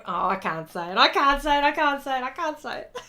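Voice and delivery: giving up, laughter taking over; helpless giggles. Real, not acted. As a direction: crack up and protest over and over. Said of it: "I'm laughing in my head before it comes out".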